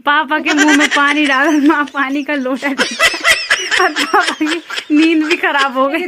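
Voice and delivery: can't stop laughing while telling it; giggling through the words. Real, not acted. Laughing after prank on papa.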